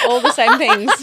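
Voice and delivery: laughing through the repeat. Real, not acted. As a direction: let the laugh spill into the words.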